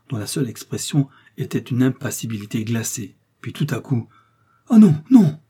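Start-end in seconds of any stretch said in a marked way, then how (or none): 4.7-5.4 high, loud panicked wail; terrified cry. Acted. Milady backs against the wall, crying out in terror. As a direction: shrink back screaming no. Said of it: "en reculant jusqu'au mur".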